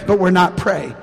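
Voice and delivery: normal talking voice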